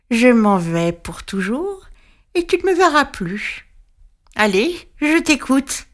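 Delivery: lightly voiced witch, sneering; the witch gloating over her prey; mocking witch. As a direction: gloat like a patient old witch. Witch voice held.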